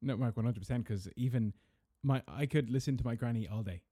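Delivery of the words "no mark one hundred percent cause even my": ordinary talk, nothing marked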